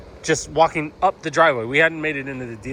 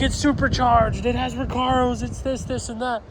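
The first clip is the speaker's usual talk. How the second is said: higher, eager rundown of features; eager friend; 10 semitones higher than usual. Retold or imitated. Friend Carlos listing the car's features, high eager voice. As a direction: sell the car like a fan. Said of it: "He's like".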